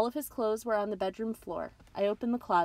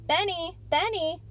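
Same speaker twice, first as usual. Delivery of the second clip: calling out; 11 semitones higher, 5 dB louder, slower than usual. Acted. Dad calling to son's room.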